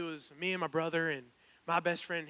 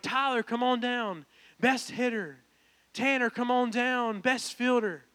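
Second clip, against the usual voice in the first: high, loud awards call-out; calling out names; 5 semitones higher, 7 dB louder, slower than usual. Retold or imitated. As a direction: summon each winner to come up front. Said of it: "calling out people's names".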